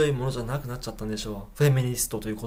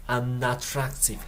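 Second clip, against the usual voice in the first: slow repeat; much slower than usual. Shown as a demonstration. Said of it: "slow teaching repeat".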